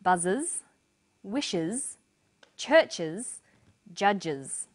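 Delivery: "buzzes, wishes, churches, judges" each ending in voiced IZ; word-list reading with a pause after each, every ending IZ; final IZ examples. Shown as a demonstration. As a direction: model each word separately with a clear IZ ending. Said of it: "Other examples are".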